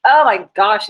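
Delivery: rising exasperated oh my gosh. Real, not acted. Teacher dismayed at her laptop to the class. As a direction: let the oh climb in dismay. Laptop frozen mid-lesson.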